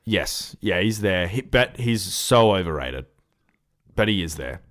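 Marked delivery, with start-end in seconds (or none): none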